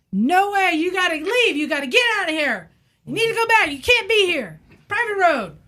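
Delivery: high, angry imitated yelling; mock screaming. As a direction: order them off his land, furious. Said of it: "he's just screaming".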